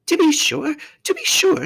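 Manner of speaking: very high, excitable faun voice; flustered faun. A narrator's reading. Faun realising the girl is human, high and flustered.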